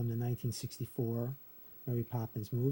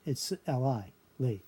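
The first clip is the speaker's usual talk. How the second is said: ordinary talk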